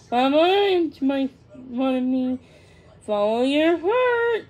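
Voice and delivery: high, loud, slow teasing call; chuckling bird. A narrator's reading. The swallow encourages Thumbelina, chuckling. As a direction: call it out slowly, rising high at the end. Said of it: "chuckled Giacomo".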